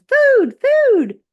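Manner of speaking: tiny high squeaky cry of food; peeping gosling. Acted. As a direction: cry it out like a hungry baby bird. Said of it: "peeped the gosling".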